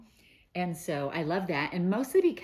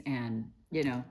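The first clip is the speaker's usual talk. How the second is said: ordinary delivery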